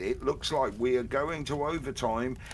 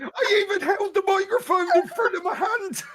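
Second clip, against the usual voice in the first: high, loud words through laughter; shrieking with laughter; cry-laughing; 5 dB louder than usual. Real, not acted. Laughing while explaining.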